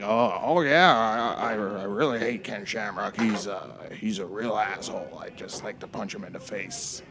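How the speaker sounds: low-key impression of a grudge-holding fighter; Don Fry. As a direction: grumble like a mock tough guy. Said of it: "And Don Fry's like".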